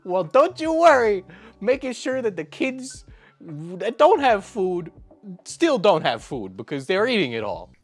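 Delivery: sarcastic mock reassurance. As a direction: fake comfort, loud and mocking. Sarcastic jab at mukbang eaters.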